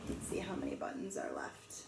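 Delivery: ordinary delivery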